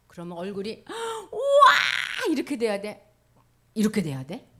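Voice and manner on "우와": high loud delighted cheer; showing the kids how to rejoice; joyful shout. Shown as a demonstration. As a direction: cheer like it's the best news. Demonstrated happy face.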